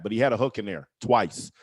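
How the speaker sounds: usual speaking voice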